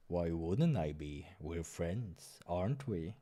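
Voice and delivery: low slow gentle; soft low reply. Acted. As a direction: softly reassure. Doctor answers softly.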